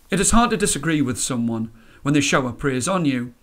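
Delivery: ordinary delivery, nothing marked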